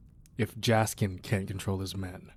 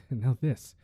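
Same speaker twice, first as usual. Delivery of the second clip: ordinary delivery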